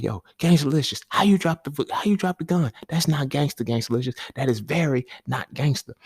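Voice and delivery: put-on high squeaky kid scolding a friend; mocking kid voice, high and sassy; Riley voice. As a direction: pitch way up, cocky little boy. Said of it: "So Riley, he's like".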